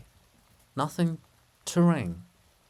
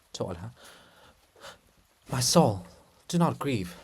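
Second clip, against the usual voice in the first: normal talking voice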